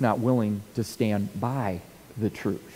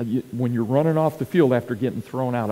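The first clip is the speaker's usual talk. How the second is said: ordinary talk, nothing marked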